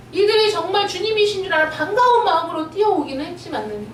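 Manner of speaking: ordinary talk, nothing marked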